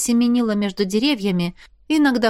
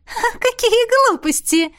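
much higher, slow dismissive laugh; 14 semitones higher, slower than usual. A narrator's reading. Marlisa laughs off Jaf's claim in a high voice. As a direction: brush it away with a mocking laugh. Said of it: "рассмеялась Марлиза".

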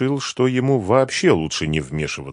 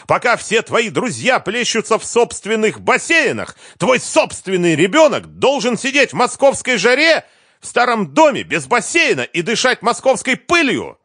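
high, loud tirade of reproach; shouted, indignant accusation; angry yelling; 6 dB louder than usual. A narrator's reading. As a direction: rail at the ex-husband at full pitch. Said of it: "крикнула Лиза".

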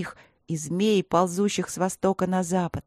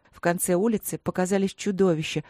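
ordinary delivery

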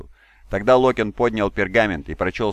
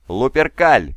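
higher, louder war cry; battle-cry shout; 5 semitones higher, 7 dB louder, much slower than usual. A narrator's reading. Warrior answering his commander's call, shouted battle cry. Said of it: "прокричал Локин".

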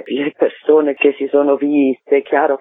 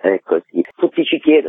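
normal talking voice

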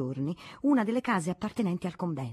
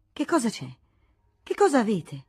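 alarmed question; 4 semitones higher, 5 dB louder than usual. Acted. Princess bursts in hearing voices.

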